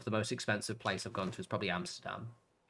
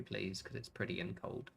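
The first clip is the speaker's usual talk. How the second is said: ordinary talk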